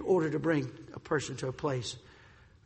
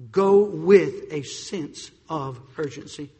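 slow and louder, punching "Go"; 8 dB louder, much slower than usual. Shown as a demonstration. Preacher raising the call to urgency. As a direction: hit "Go" harder the second time. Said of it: "repeated with contrast".

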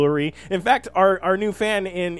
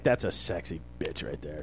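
low admiring growl; 13 semitones lower, 10 dB quieter than usual. Retold or imitated. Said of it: "I was like".

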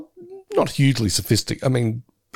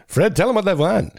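high-pitched game show host voice; 7 semitones higher than usual. Retold or imitated. Game show host calling to his announcer, showman voice. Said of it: "the game show at the start".

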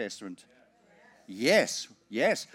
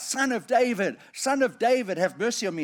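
mock cry out; 7 semitones higher, 3 dB louder than usual. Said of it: "who cried out".

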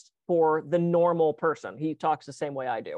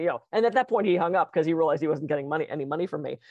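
ordinary talk

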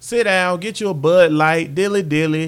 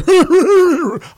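high, loud mock whinny; playful imitated whinny; horse whinny; 10 semitones higher, 8 dB louder than usual. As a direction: neigh like an excited horse.

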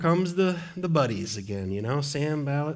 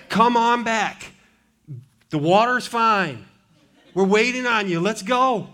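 preaching invitation; 5 semitones higher, 4 dB louder than usual. Acted.

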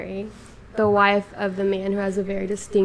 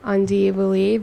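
ordinary talk

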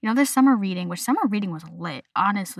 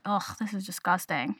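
mock disgust; 8 dB quieter than usual. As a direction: drag out the ugh, softly grossed out. Acting out disgust at work clothes.

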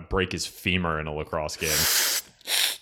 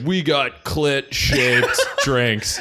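cracking up mid-line; laughing; 8 semitones higher, 8 dB louder, much slower than usual. Pretend. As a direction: laugh through the words. Parody of bachelor party.

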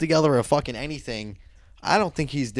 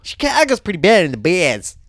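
put-on louder, higher caricature Chicago accent; mock Chicago accent; 6 semitones higher, 7 dB louder than usual. Comparing bad regional accents, mock Chicago accent. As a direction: lay the Chicago vowels on thick. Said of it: "what's worse, the Chicago accent or the Boston accent?".

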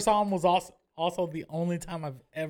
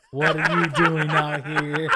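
put-on slow, louder, teary old man line; mock tearful old dad; 4 semitones lower, 6 dB louder, slower than usual. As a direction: play the old father, half crying, half laughing. Old dad in movie parody.

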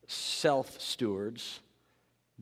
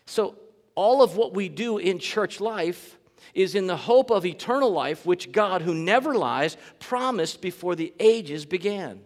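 louder, higher preacher's delivery; raised, forceful pulpit voice; emphatic preaching; 7 semitones higher, 7 dB louder than usual. Real, not acted. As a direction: drive the point home to the congregation.

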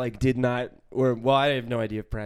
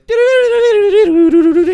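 mock accent babble; 14 dB louder than usual. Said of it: "you immediately were like".

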